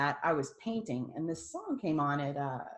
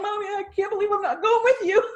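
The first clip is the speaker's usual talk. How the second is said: high, loud mock wail with laughter in it; imitated crying; 9 dB louder than usual. Daughter retelling her words to dying mother, laughing wail. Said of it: "And I'm crying".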